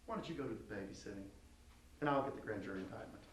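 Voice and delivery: soft, patronizing put-down. Shown as a demonstration. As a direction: talk down to him quietly. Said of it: "It's sort of patronizing".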